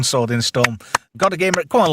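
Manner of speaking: ordinary talk, nothing marked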